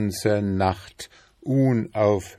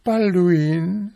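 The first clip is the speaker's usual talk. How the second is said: high-pitched, fussy wife voice, louder; nagging wife beetle; 13 semitones higher, 6 dB louder than usual. A narrator's reading. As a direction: pester him in a high, insistent voice. Wife calls husband.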